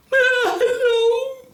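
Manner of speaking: high, loud tearful greeting; sobbing hello. A narrator's reading. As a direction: greet him through sobs. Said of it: "said the woman".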